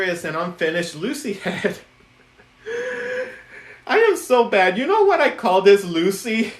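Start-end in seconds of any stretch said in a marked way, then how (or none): 2.6-3.3 squealing burst of laughter; a high giggle that escapes; real squeal of a laugh. Real, not acted. Laughs at unfinished head. 3.9-6.5 loud, higher giggly confession; half-laughing, delighted with herself. Real, not acted. Laughing at her own joke.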